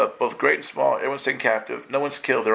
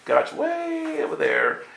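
distant God; slower than usual. Retold or imitated. Preacher mimics distant God.

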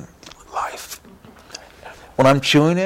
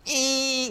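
gleeful squeal; 13 semitones higher, 5 dB quieter than usual. Retold or imitated. Glowing after communion.

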